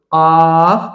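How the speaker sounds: কফ loud, with a long vowel; drawn-out letter name. Shown as a demonstration. Teacher naming a letter for learners, drawn out. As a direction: stretch the vowel in কফ. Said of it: "টেনে বলতে হবে".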